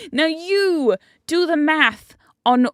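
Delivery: loud, slow, high commanding queen; bossy queen. Retold or imitated. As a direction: order the sub around, drawn out. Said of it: "and she's like".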